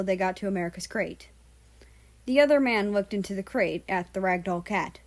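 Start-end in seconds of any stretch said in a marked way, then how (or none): none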